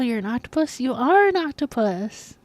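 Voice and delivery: ordinary talk, nothing marked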